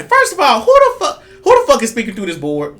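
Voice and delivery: high, loud, incredulous demand; mock indignation. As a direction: challenge the impostor with hammed-up outrage. Acts indignation at suspect spirit.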